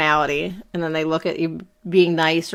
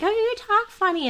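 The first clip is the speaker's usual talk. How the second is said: put-on teasing kids. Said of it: "the kids would always be like".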